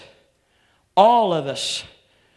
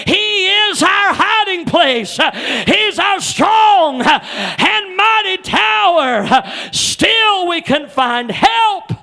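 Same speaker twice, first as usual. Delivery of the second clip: preaching at full force; 9 semitones higher, 7 dB louder than usual. Acted. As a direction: build the sermon up to a ringing cry. Preacher building to full shout.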